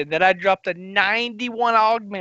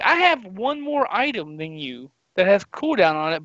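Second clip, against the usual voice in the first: usual speaking voice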